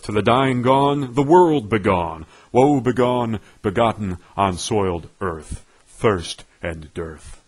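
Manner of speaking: usual speaking voice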